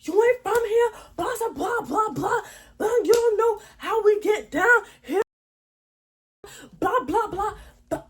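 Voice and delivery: high, loud mocking rant; mock yell. Narrator to the audience, mimicking the girl's high screeching rant. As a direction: screech her threats like a cartoon bully. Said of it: "Just all in my face".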